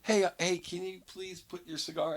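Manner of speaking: soft, high, gentle ask; polite request. Pretend. How the lady should have asked about the cigar, soft and polite. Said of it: "If she just would've said".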